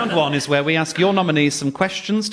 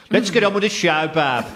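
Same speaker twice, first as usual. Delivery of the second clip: put-on broad playful Birmingham accent, a bit higher; hammed-up Brummie sign-off; mock Brummie; 6 semitones higher than usual. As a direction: lean into a jokey Brummie "Bab". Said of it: "Being from Birmingham".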